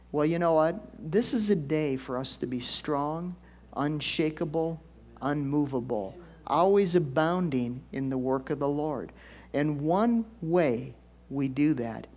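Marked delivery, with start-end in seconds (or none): none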